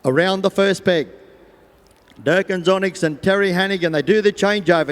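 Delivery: normal talking voice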